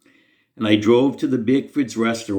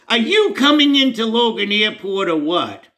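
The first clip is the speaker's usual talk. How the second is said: higher, impatient call from a car window; mild mock impatience, pitched up; impatient pastor; 10 semitones higher than usual. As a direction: pastor hollering from the passenger seat, a touch impatient.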